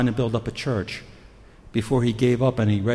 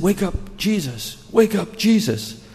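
high-pitched plea to wake up; acted-out alarm, pitched up; urgent waking call; 7 semitones higher, slower than usual. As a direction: rouse a sleeper urgently. Voices disciples waking Jesus in storm.

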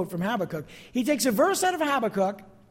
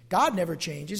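usual speaking voice

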